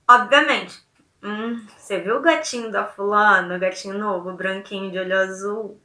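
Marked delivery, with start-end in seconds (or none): 1.2-5.8 hushed and suggestive about the handsome man; lowered, insinuating gossip. Shown as a demonstration. Teacher showing how speech makes the meaning clear, insinuating. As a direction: murmur it like juicy gossip. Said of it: "Na oralidade, eu vou deixar claro".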